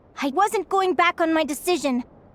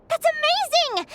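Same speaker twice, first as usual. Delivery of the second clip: much higher, thrilled burst; overjoyed exclamation; delighted; 12 semitones higher than usual. Acted. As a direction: gush with sudden delight. Thrilled Aquara can track Salino.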